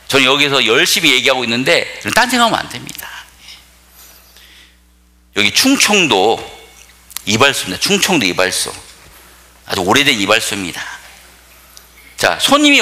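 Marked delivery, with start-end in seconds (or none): none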